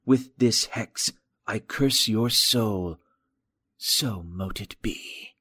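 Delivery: slow whispered hex, rasped down the line; slow, breathy-edged ritual chant of a curse; rasped phone curse. Acted. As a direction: hiss the curse slowly like a hidden caller casting a spell. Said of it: "The voice on the phone rasped".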